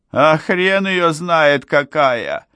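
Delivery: high, drawn-out retort; slow, high-voiced exasperation; exasperated retort. A narrator's reading. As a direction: snap back, at a loss and pitched high. Flustered pilot snaps back.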